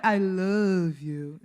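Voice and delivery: slow, low American-accented English; foreign accent. Shown as a demonstration. Demonstrating the foreigner's version, American accent. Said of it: "O sotaque estrangeiro é".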